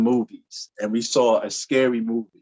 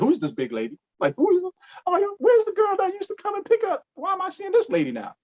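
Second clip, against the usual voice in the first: high, startled who-is-this; shocked disbelief. Retold or imitated. Man waiting at schoolyard, shocked by a stranger, high squeal.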